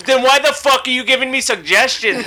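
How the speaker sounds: high, exasperated retold outburst; mad. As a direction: act out the furious comeback you kept in your head. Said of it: "I was so mad".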